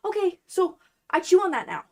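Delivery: high, loud, eager voice for the cat; cat's voice. Retold or imitated. Owner voicing what her smart cat would say, cat's voice. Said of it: "he'll be like".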